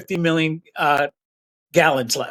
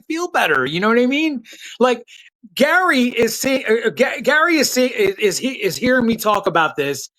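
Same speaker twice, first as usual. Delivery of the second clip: usual speaking voice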